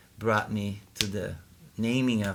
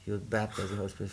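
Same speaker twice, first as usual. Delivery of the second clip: ordinary delivery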